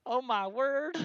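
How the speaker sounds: very high, loud Southern Belle exclamation; hammed-up, high Southern Belle. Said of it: "do it in the Southern Belle voice".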